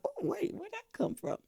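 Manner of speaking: surprised. Retold or imitated. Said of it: "you're like".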